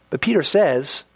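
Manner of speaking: ordinary talk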